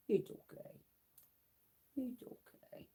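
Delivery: hushed reassuring whisper; calm the frightened kitten in a whisper. Real, not acted.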